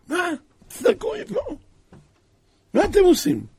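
a high, loud outraged demand; king rebuking Haman's sons, high and loud; angry king. Retold or imitated. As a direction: scold them, pitch shooting up.

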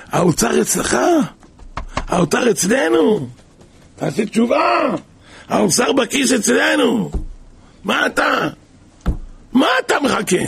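high, rising preacher's cry; fervent exhortation. Real, not acted. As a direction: urge them to wake up, voice climbing. (קריאה נלהבת ומתגברת)

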